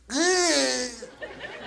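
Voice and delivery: high, drawn-out squeal; high held yelp; pained squeal. Retold or imitated. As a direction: imitate a kid squealing from a hit. Demo of brother's sound when hit.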